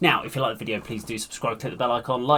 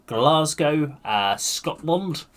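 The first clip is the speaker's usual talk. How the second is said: slow, badly faked Scottish accent; 5 semitones higher, 4 dB louder, much slower than usual. Telling viewers where the band is from, bad Scottish accent. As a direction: put on a terrible Scottish accent, drawn out. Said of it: "that was the worst accent I've ever done".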